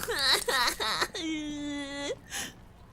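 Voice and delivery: high laugh tailing into a wail; whining laugh. Real, not acted. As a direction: laugh that nearly turns to crying. Laughing over lost morale.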